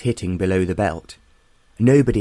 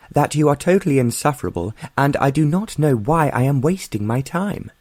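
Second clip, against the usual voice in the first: fast, higher, heated outburst; stormy retort; 7 semitones higher than usual. A narrator's reading. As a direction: rattle it off in a temper, pitched up. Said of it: "Gina countered stormily".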